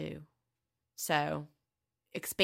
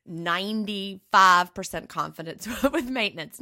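laughing; 2 semitones higher, 8 dB louder than usual. Real, not acted. Laughs mid-claim.

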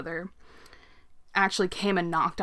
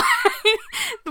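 nervous laugh; 6 dB louder than usual. Real, not acted. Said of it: "me laughing right now".

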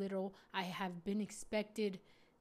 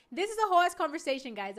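excited aside; 7 semitones higher, 10 dB louder, faster than usual. Real, not acted. Laughing aside to viewers.

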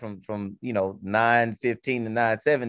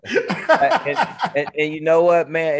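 laughing; 7 semitones higher, 6 dB louder, slower than usual. Real, not acted. Laughing as the point lands.

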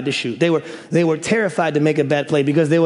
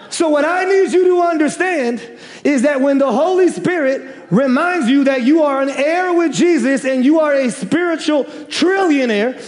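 preaching at full force; 9 semitones higher than usual. Acted. Pastor whipping up the congregation, joyful preaching shout. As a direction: bring the house down with joy.

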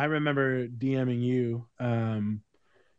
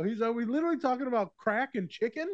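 incredulous friend; 9 semitones higher than usual. Retold or imitated. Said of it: "he's like".

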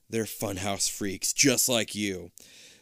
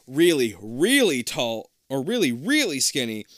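ordinary delivery, nothing marked

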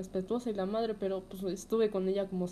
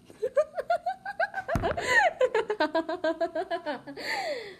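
shrill drawn-out laugh; long high laugh; 3 dB louder than usual. Real, not acted. Long laugh after dark joke. (risa aguda y prolongada)

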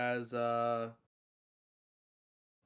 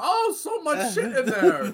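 high, loud, drawn-out exclamation; hammed-up cry of a cartoon character; mock cartoon outcry; 14 semitones higher, 7 dB louder, slower than usual. Predicting the sewer pipe joke to friends, hammed-up high outcry. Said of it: "it's gonna be like".

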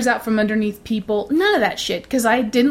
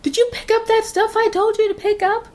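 put-on high, carrying call up to the kids; mock mom hollering from the stairs; mother yelling upstairs; 12 semitones higher than usual. Mother calls to her kids from the stairs, high and carrying. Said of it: "she yells at the kids".

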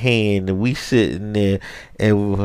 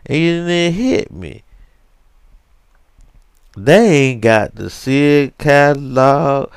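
normal talking voice

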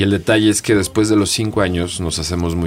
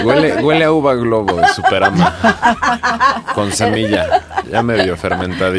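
talking through laughter; laughing; 5 semitones higher, 6 dB louder than usual. Real, not acted. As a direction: laugh while describing the smell. Laughing tasting comment.

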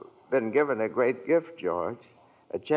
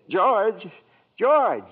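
louder, higher calls; calling after; 6 semitones higher, 5 dB louder, slower than usual. Acted. Clarence calling after George as he runs off. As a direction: shout after him as he runs.

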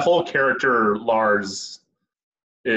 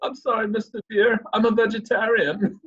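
put-on high goofy accented voice; Lars impression; 9 semitones higher than usual. Friend quotes Lars pleading while tied to a tree, high-voiced. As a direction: plead with the bear in a squeaky voice. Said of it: "he's like".

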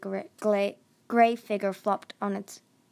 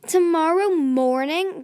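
high, loud, drawn-out incredulous echo; outraged disbelief; 7 semitones higher, 7 dB louder, slower than usual. A narrator's reading. Glory told by Clay to wait till morning, outraged disbelief. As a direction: throw the words back in indignant disbelief.

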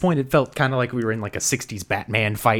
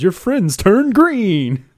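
put-on slow, loud, much higher drunken drawl; town drunk; 11 semitones higher, 9 dB louder, much slower than usual. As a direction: stretch it out like a tipsy old sailor. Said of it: "a town drunk comes out".

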